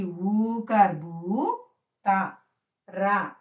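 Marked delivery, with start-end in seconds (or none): none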